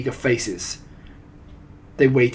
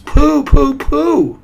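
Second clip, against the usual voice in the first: very high, loud hooting; disgusted cry; 13 dB louder, much slower than usual. A narrator's reading. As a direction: squeal it out repeatedly. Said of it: "cried the audience".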